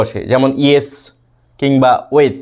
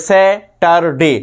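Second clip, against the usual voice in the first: Saturday slowly as স্যা, টার, ডে; three-part split; much slower than usual. Shown as a demonstration. Teacher repeats the example for students, slow Saturday in three beats. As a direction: separate the three syllables of Saturday. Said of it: "তিনটে পার্টে যাবে".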